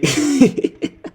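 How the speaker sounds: sudden high burst of laughter. Real, not acted. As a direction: cackle at how silly it is. Laughs at absurd threat.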